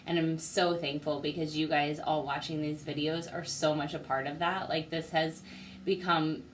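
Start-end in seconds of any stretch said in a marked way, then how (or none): none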